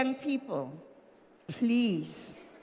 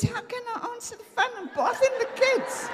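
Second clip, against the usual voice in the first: high, laughing mock Fred voice; laughing take-off of her husband; 11 semitones higher than usual. Fred protesting to his wife from the bath, high laughing mock. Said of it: "He says".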